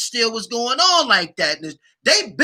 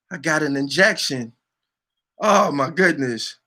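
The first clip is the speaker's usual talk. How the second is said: ordinary delivery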